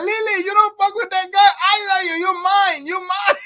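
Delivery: high, pleading and possessive; jealous inmate. Retold or imitated. Jealous inmate shouting at Lily through the window, high and possessive. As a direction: claim her, mock heartbroken. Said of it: "And he's like".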